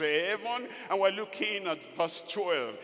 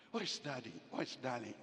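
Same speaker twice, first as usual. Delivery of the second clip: quiet calling; 5 semitones lower, 11 dB quieter, faster than usual. Retold or imitated. Wife searching for husband.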